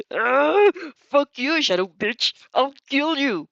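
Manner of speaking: high, loud enraged yelling; furious outburst. Acted. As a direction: explode at her, screaming threats. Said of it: "He explodes right and around then".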